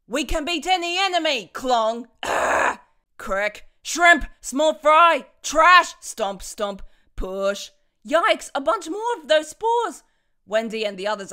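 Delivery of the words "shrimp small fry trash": insults yelled. Acted. Villain hurling insults.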